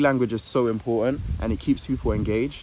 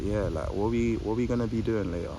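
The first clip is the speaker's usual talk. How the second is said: calm it down; 2 semitones lower, 5 dB quieter than usual. Shown as a demonstration. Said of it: "calm it down".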